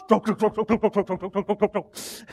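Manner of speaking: rapid-fire panicked rowing chant, high and loud; comically frantic, machine-gun stroke count. Acted. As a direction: rattle off the strokes like a cartoon scramble. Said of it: "they're probably a little bit frantic".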